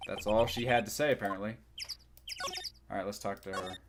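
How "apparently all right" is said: normal talking voice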